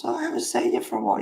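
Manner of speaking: high, very fast breathy sing-song; mock neighbour greeting. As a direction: rattle off the neighbour's fake-friendly greeting. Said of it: "And then someone else".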